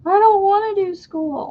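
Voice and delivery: put-on high, whining child's complaint; whiny kid. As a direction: whine like a kid avoiding lessons. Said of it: "I hear that".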